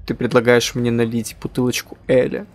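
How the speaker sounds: ordinary delivery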